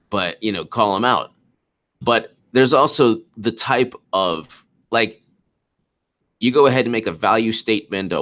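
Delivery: normal talking voice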